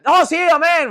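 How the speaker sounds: acted sudden enthusiasm; mock hasty agreement. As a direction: join in as if jolted awake. Startled sleeper joining in.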